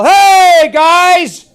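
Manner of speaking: high, loud shout; yell. Shown as a demonstration. Trainer yelling to show dogs the noise is realistic, loud yell. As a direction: holler out to the room. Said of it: "If I yell".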